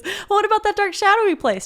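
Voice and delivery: very high, loud cub voice; young Simba. Acted. Simba questioning his father in The Lion King, high cub voice. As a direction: pipe up as little Simba. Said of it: "that part in The Lion King".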